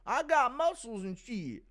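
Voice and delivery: high, loud cartoonish muscleman voice; Terry Crews. Streamer impersonating an actor for a viewer, high and loud. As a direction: push it high and drawn out. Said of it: "impression of Terry Crews".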